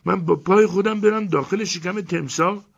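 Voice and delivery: very high, loud indignant woman's voice; outraged yelling. Acted. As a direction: Elena rants about entering the crocodile, outraged. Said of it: "داد و غار را انداخت".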